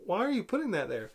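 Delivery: lightly mocked cheesy delivery, swooping pitch; hint of corny overacting; super cheesy. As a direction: ham it up with sing-song rises and dips. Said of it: "it just goes super cheesy".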